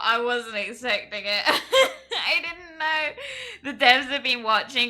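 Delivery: loud, laughing disbelief; overwhelmed laughter. Real, not acted. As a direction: talk through real giddy, teary laughter. Overwhelmed by surprise in game.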